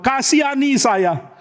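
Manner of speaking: high, drawn-out plea; acted imploring cry; pleading. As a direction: beg God for mercy, stretching the words. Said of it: "Daud mohon kepada Tuhan".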